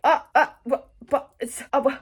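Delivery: high, loud stammering; mock speechless bafflement. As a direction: sputter broken syllables in exaggerated disbelief. Acts out speechless reaction to plot.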